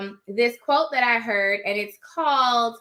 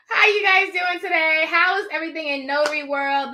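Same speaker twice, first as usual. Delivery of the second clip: ordinary talk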